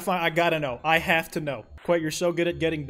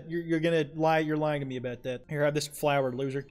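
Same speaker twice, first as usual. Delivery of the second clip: ordinary talk, nothing marked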